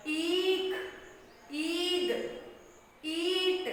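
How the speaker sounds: ईख, ईद, ईट each with a drawn-out ee; long ee. Shown as a demonstration. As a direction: stretch the first vowel of ईख, ईद, ईट. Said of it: "वो लंबा खींचता है".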